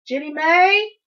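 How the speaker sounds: loud, high rising call; mother's summoning shout. A narrator's reading. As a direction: call a child from across the house. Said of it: "Ma called out".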